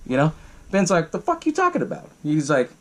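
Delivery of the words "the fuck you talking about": mock incredulous retort. Retold baffled retort in argument.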